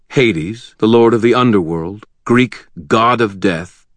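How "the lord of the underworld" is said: usual speaking voice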